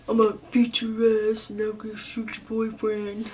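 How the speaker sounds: mock tough-dad threat; threatening angry dad. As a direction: put on an overprotective father's menacing voice. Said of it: "not the kind of dad that's like".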